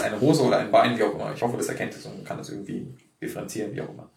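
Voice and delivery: ordinary delivery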